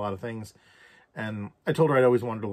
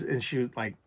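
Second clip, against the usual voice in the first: usual speaking voice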